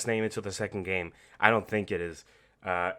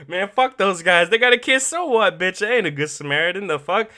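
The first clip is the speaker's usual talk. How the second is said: mock callous Joel; 6 semitones higher, 8 dB louder than usual. Mock Joel's selfish attitude.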